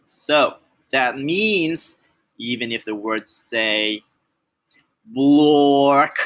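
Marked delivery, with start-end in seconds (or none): 5.1-6.2 loud, drawn-out blurt of nonsense; silly nonsense word. Pretend. Reader forced to say nonsense words, silly blurt.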